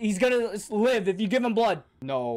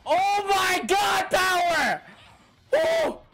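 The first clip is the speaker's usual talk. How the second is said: excited shout; 9 semitones higher than usual. Real, not acted. Viewer cheering as Power appears, excited shout. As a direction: shout it with hype. Reacting to action scene excitedly.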